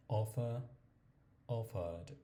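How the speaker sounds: British English, no final r in offer, cover, consider; British accent, non-rhotic endings; British pronunciation. Shown as a demonstration. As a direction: drop the r like a British speaker. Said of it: "Primero empezamos con la británica".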